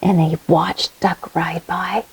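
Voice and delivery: unvoiced hushed narration; whispered narration. A narrator's reading. Storyteller whispering about Cat watching Duck. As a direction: hush it like a secret.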